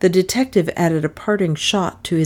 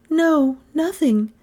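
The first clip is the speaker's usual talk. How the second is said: a high, lightly voiced young woman; shaky little no; frightened girl's denial; 10 semitones higher, slower than usual. A narrator's reading. The girl answers the detective's question in a high voice.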